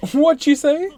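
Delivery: loud, high, playful "what'd you say"; mock shout. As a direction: yell it like a taunt to provoke a reaction. Teasing friends to react louder.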